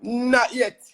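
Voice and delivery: quick, high, loud denial; emphatic no. Real, not acted. Answering whether he misses home.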